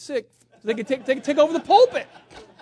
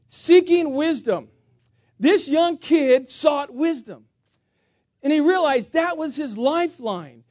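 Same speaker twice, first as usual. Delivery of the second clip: slow, loud preaching; preaching emphasis; 4 semitones higher, 8 dB louder, slower than usual. Real, not acted. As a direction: drive the lesson home, slowly. Preacher driving the point home.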